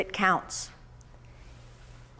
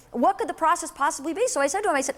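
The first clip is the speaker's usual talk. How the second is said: ordinary delivery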